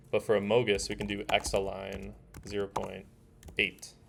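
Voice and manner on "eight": usual speaking voice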